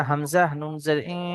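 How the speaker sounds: ordinary talk, nothing marked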